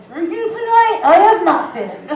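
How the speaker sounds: mock drunk slur; drunk slurring. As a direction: slur it like you're tipsy. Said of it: "I slurred".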